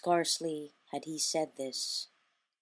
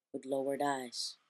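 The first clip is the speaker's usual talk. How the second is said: ordinary delivery, nothing marked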